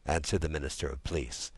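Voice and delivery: ordinary delivery, nothing marked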